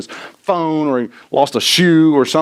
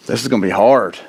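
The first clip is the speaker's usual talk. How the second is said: deflated dread; 6 semitones lower than usual. Retold or imitated. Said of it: "that was your first thought".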